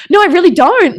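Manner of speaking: high, loud retold protest; pleading protest. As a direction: act out helpless insistence to the teacher. Acted protest to ignoring teacher.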